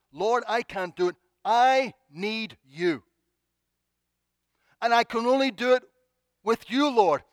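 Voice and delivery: high, loud, slow appeal to God; emphatic preacher's plea; acted cry for help. Crying out to the Lord.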